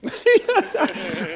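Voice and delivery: squealing high laugh. Real, not acted. Pastor cracking up at the memory, high laugh. Laughing at his own story.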